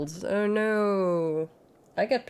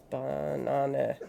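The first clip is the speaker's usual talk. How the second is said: normal talking voice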